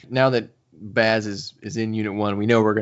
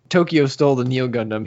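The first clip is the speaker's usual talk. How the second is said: ordinary talk, nothing marked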